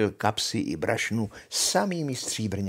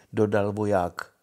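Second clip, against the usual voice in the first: ordinary delivery, nothing marked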